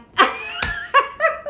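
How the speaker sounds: high breathy burst of laughter. Real, not acted. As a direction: laugh at your own bit, high. Laughs at own quote.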